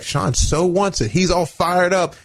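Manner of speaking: mock the coach's doting tone; imitating the coach. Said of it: "And the coach would be like".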